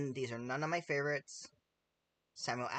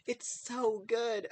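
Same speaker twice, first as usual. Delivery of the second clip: mock gushing; 7 semitones higher, much slower than usual. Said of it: "what did she say a moment ago".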